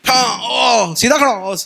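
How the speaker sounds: strict PE teacher. Retold or imitated. PE teacher shouting at schoolboys in line, barking. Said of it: "PT वाले होते थे सख्त".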